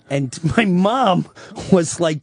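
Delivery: ordinary talk, nothing marked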